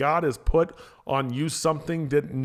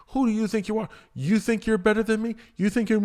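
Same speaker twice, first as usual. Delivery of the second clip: mock sneer, pitched higher; condescending; 7 semitones higher than usual. Imitating someone scoffing at a rival, condescending. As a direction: scoff at someone you look down on. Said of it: "angry, very condescending way".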